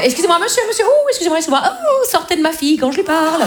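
a hint of an outraged mom; mock mother scolding. Mother shooing the boyfriend away, flustered and high.

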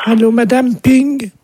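high-voiced wife on the phone; put-on wife. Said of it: "C'est ma femme".

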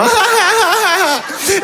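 high forced courtesy giggle; squeaky ingratiating laugh; polite laugh. Retold or imitated. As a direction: fake laugh for the banker. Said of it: "le rire de politesse".

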